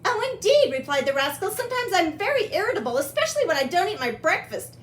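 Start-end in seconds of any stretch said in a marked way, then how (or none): 0.0-0.7 very high, proper reply; high-pitched genteel character; refined rascal. Acted. Refined rascal answers Pete. 1.5-4.8 high-pitched, proper recruit; light, high genteel character voice; refined rascal. Acted. Refined rascal answers Pete.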